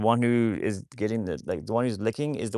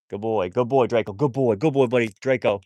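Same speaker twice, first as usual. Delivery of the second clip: bright, louder praise for a good dog; 7 dB louder than usual. Real, not acted. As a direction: gush over the dog for coming back. Praising his dog Draco.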